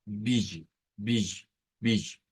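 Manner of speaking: teacher sounding out a syllable; syllable demo. Shown as a demonstration. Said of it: "vea sonado".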